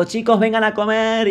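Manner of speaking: high, drawn-out imitation of a mother calling. Mother calling the couple to come and eat, calling out. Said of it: "la madre capaz nos gritaba".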